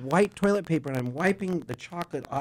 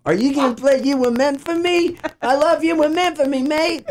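high-pitched hammed-up Aussie fan; terrible Australian accent; 10 semitones higher than usual. Girl in the back calls out a song request, bad Australian accent. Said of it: "I do a terrible Australian accent".

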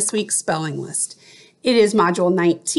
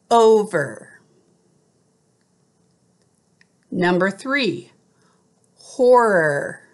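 teacher reading out spelling words; clear, careful dictation of each word; slow school dictation. Shown as a demonstration. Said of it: "I will read the word".

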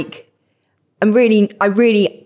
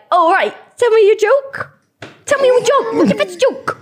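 high, mock pushy lad; lads at pub; 11 semitones higher than usual. [name] imitating lads demanding a joke, high and mock pushy. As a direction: ham up a demanding stranger. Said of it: "And they're like".

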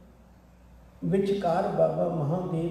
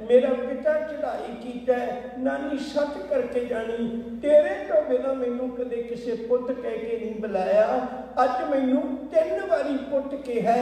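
put-on high, louder, emotional outpouring of a boy; moved boy's plea; 7 semitones higher, 3 dB louder than usual. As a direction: let it rise and spill over like a child who was never loved. Boy pours out his heart. (ਉੱਚੀ ਭਾਵੁਕ ਬੱਚੇ ਦੀ ਆਵਾਜ਼)

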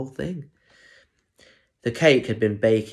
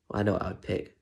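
usual speaking voice

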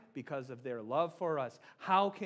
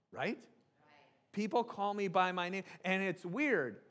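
normal talking voice

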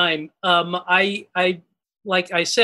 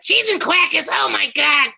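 high, bright-eyed cartoon exclamation; cartoon character voice; 6 semitones higher than usual. Backstage in the theater hallway, cartoon character catchphrase voice. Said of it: "you sound like this cartoon character I know".

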